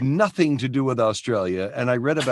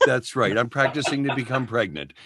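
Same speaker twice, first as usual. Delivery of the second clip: normal talking voice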